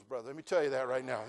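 ordinary talk, nothing marked

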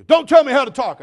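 loud, high-pitched angry outburst; imitating a cranky man shouting someone down; crotchety old man. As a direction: snap at full volume like a crotchety old man. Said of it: "crotchety old rotten dude".

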